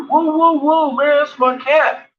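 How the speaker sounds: high, loud call to back off; stepping in to stop a fight, a high loud whoa. Retold or imitated. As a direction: shout them down, step in for your friend. Friend steps in to stop fight.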